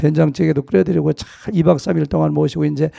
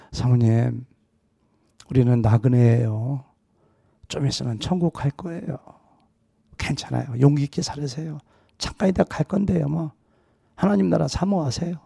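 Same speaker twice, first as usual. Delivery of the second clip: gentle comfort; 5 dB quieter than usual. Retold or imitated. Pastor comforting the grieving widow.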